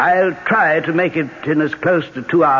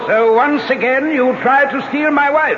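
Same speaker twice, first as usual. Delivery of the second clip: high, raised confrontation; jealous accusation; 10 semitones higher than usual. Acted. Husband catches wife's lover, angry accusation. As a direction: accuse the man who wants your wife.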